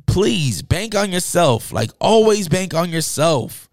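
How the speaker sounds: slow, high-pitched emphatic urging; a heartfelt plea to the viewer, spelled out slowly; earnest plea. Real, not acted. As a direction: lean on each word like you mean it. Urging listeners to self-reliance.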